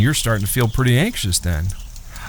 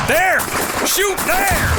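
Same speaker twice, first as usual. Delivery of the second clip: high panicked shout; panicked yell; slower than usual. Acted. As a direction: yell at the brothers to shoot. Panicked gunfight in dark woods.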